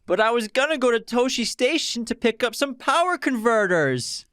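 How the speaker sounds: put-on high, whining young farm boy; whiny Luke voice. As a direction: whine it like a sulky teenager. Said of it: "In a very whiny voice".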